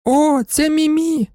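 put-on high, drawn-out child's cry; calling little girl. A narrator's reading. Little girl recognizes her doll in court, calling out high. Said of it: "гукнула Гелюня".

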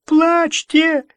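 very high, loud, slow wail; wailing prophet. A narrator's reading.

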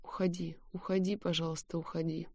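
hushed, soft mental plea; whispered plea. A narrator's reading. Heroine silently begging him to leave, whispered plea. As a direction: plead under your breath, quietly. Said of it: "мысленно шепча".

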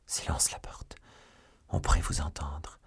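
soft, whispery warning; secretive undertone at a deathbed; hushed. A narrator's reading. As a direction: keep it down, nearly whispering. Urgent hush beside the dying duke.

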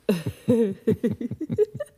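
short, high, pleased laugh; a self-satisfied chuckle; gloating laugh. Acted. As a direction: laugh at your own cleverness. Gloating after clever answer.

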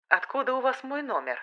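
сухой, тихий вопрос майора; спросить сухо и холодно. A narrator's reading. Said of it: "сухо поинтересовался он". (сухо, без интонации)

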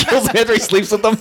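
high-pitched, laughing question; amused disbelief. Real, not acted. Podcaster echoing co-host's misunderstanding, laughing in disbelief. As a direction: repeat it back through real laughter.

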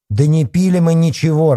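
high, loud indignant denial; irritated shout. A narrator's reading. Girl denies drinking to the policeman, irritated shout. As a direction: shout back, fed up. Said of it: "раздражённо крикнула ему Санька".

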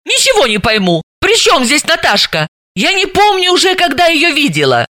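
high-pitched exasperated self-defence; angry protest. A narrator's reading. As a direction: protest crossly, pitch climbing. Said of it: "рассердилась Далила". (сердито, оправдываясь, высоким голосом)

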